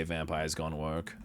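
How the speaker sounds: low, resigned sigh of a line; weary mock resignation. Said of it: "I immediately sigh and say".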